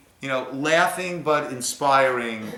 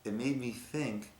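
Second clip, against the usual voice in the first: soft moved Rebbe; 3 semitones lower, 13 dB quieter than usual. Retold or imitated. Rebbe answers softly, moved.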